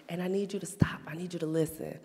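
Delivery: whispered firm warning to her daughter; stern whisper. Retold or imitated. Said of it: "I was like".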